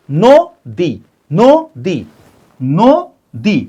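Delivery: "নদী" in two beats, a clear pause between নো and দী; split into syllables. Shown as a demonstration. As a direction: break "নদী" after the first syllable. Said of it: "দুই ভাগে ভাগ করে উচ্চারণ করি". ("নদী" দুই ভাগে থেমে থেমে, নো... দী)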